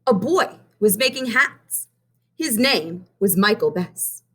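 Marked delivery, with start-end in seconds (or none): none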